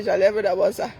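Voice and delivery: ordinary talk, nothing marked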